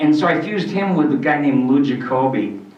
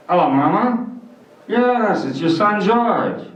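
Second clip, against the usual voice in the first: higher, louder marble-mouthed vaudeville voice; George Jessel impression; 6 semitones higher, 5 dB louder than usual. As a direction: mush the words like an old emcee. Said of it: "And he talked like this".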